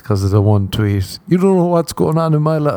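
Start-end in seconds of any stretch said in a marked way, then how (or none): 1.3-2.7 high-pitched mock complainer; mock aggrieved. Stranger replying upset to a tweet, mock aggrieved. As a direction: imitate an offended stranger in a raised, whiny pitch.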